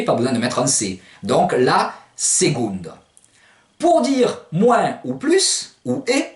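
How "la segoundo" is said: LA SECONDE slow, as Provençal "segoundo"; slow seconde with g and ou, "se-GOUN-do"; hard G. Shown as a demonstration. Teacher recapping the word, slow hard-G pronunciation. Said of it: "puisqu'on entend G".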